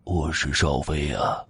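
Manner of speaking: hushed cold voice of the dead; icy voice. A narrator's reading. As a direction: speak low-key and chilly, drawing the words out. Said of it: "邵飞也用同样冰冷的声音说道".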